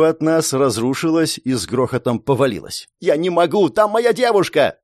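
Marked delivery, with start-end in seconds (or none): 3.0-4.8 high, urgent refusal; plead, with pitch climbing; desperate protest. A narrator's reading. Hero refuses to leave his girlfriend, desperate high cry.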